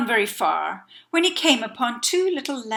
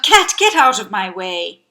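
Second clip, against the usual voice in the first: put-on loud, hurried old man's order; raised voice, ordering someone aside; shouted; 4 semitones lower, 10 dB louder than usual. A narrator's reading. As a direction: shout to clear the road. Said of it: "and he shouted".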